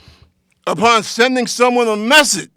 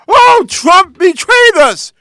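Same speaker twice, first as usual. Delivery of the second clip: mock whine; 6 semitones higher, slower than usual. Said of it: "you wanna say".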